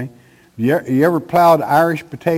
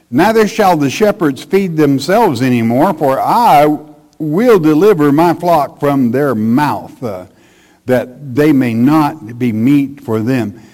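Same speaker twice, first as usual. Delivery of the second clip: louder, emphatic preacher's Bible reading; forceful scripture reading; 5 dB louder than usual. Acted. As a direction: proclaim the verse from the pulpit. Reads scripture aloud forcefully.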